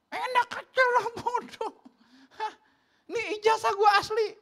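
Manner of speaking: very high, loud protest; mock indignation. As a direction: play offended, squealing in comic outrage. Mock indignant comeback to insult. (protes pura-pura tersinggung, melengking)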